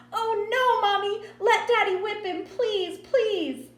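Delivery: high, loud, frantic begging; crazed, hysterical child. A narrator's reading. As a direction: shriek pleas like a little girl gone wild. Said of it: "Joetta saw the Vaseline and went crazy".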